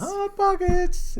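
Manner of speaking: very high, slow drawn-out jingle; Hot Pockets impression. Echoing friend's comedian bit, high slow jingle. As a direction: stretch it high like the ad.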